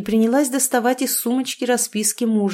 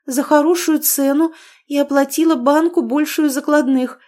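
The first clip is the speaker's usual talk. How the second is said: feigned surprise; 7 semitones higher, 5 dB louder than usual. A narrator's reading. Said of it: "деланно удивилась".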